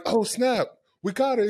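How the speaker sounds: high-pitched retold thrill; imitating giddy surprise; excited. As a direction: relive the catch, pitch jumping up. Retold excitement at catching puck.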